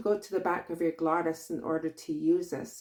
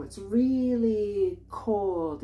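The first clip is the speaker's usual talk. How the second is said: "It's really cold" very slow, higher, dramatic accented drawl; her First Nations accent; 3 semitones higher, 3 dB louder, much slower than usual. Shown as a demonstration. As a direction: drag out "really cold" in the full heritage accent. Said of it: "You hear my accent already".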